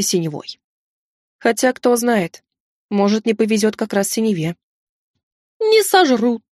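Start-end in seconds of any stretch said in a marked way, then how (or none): 5.6-6.4 much higher owl voice; talking owl. A narrator's reading. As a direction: pipe up as a high-pitched owl. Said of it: "подала голос сова".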